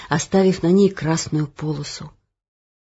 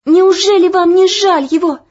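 high, loud breathless pleading; breathless plea; 12 semitones higher, 8 dB louder than usual. Acted. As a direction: gasp it out in distress. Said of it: "задыхаясь".

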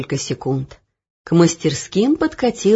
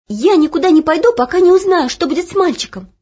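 high, loud and fast defiance; stubborn; 11 semitones higher, 6 dB louder, faster than usual. Acted. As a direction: refuse stubbornly. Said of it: "Упрямо тряхнула косичками Оля".

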